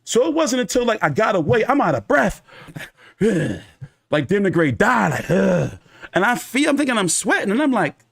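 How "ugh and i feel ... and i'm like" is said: usual speaking voice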